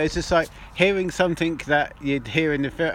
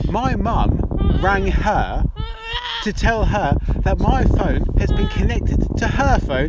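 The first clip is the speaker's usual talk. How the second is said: slow, higher-pitched, emphatic storytelling; drawn-out reveal; 4 semitones higher, 4 dB louder, slower than usual. Real, not acted. Friend revealing who overheard the graphic chat, drawn-out reveal. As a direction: spell out the punchline piece by piece.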